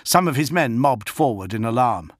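plain reading voice

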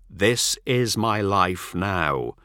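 very slow, each word set down; a farmer refusing to budge; stubborn insistence. Acted. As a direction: hold the line, word by word.

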